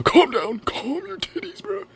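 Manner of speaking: high falsetto squeal, drawing out the second phrase; mock calm-down. Telling impatient viewers to wait till Tuesday, in mock falsetto. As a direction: squeak it in a comic, sky-high voice.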